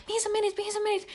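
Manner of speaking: imitating a clingy guy who keeps asking; needy guy spamming. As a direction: lightly mimic a clingy texter asking where she went. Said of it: "Se spämmii mulle".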